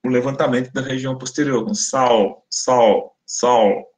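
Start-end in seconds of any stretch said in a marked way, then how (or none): 1.7-3.9 "sal" ending in a dark English-like L; velarized L. Shown as a demonstration. Teacher showing southern speakers' L, dark velarized L. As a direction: say "sal" with the back of the tongue raised on the L. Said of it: "tipo um L do inglês".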